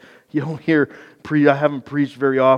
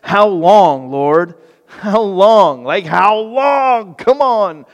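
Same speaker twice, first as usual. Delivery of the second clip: loud, higher anguished cry to God; Habakkuk's cry; 5 semitones higher, 9 dB louder, slower than usual. Acted. Habakkuk cries out to the Lord, loud and pleading. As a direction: cry out to heaven in frustration. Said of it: "his complaint starts off with".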